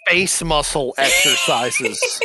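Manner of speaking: drawn out, laughing; slow quoted phrase. Retold or imitated. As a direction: stretch each word with a laugh. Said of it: "I quote".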